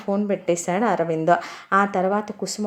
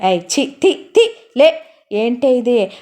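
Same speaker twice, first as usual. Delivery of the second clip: loud, high yelp of disgust; husband yelling as his wife cuddles up; startled shout; 5 semitones higher, 6 dB louder, slower than usual. Acted. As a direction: shout her off in a fright. Husband shoves off clingy wife.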